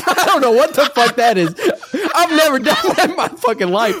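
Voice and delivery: cracking up while quoting the line; amused, laughter-broken imitation; laughing retelling. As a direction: quote it through helpless laughter. Laughing while retelling Sukuna's reply.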